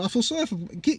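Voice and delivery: ordinary delivery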